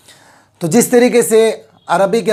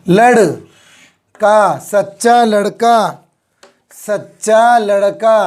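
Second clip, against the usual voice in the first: slow reading; much slower than usual. Shown as a demonstration. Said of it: "क्या पढ़ेंगे इसको".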